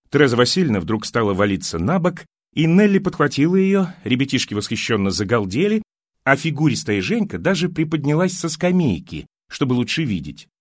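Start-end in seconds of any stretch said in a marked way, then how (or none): none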